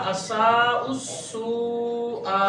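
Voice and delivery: असा उस्सू आ drawn out with long vowels; slow joined recitation. Shown as a demonstration. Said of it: "तो ये बनेगा". (असा उस्सू आ को धीरे, खींचकर)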